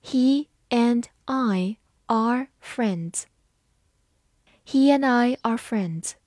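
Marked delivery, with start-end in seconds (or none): 0.0-3.2 slow, word by word; deliberate teacher demo at half speed; slow reading. Shown as a demonstration. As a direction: read it slowly, pausing between words. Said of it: "我们慢慢来读一遍".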